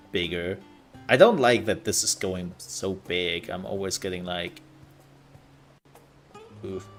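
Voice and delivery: normal talking voice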